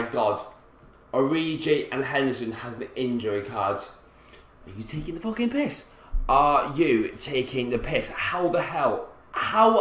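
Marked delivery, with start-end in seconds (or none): 3.8-5.8 soft start climbing to a high squeal; incredulous squeak. Real, not acted. Gamer finding injured players, incredulous rising squeak. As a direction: voice rising higher and higher in disbelief.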